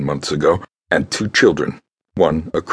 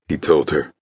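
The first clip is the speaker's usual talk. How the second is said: usual speaking voice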